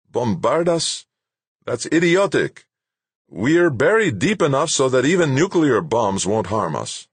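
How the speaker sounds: high, incredulous scoff in character; indignant researcher, pitch jumping up; scornful scientist. Acted. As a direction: dismiss it as utter nonsense. Narrator voices scientist scoffing.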